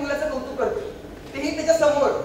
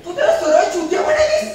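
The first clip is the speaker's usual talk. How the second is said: higher and louder, laughing in disbelief; can't believe she said it, crack up; shocked laughter; 6 semitones higher, 5 dB louder than usual. Acted. Friend shocked at the insult.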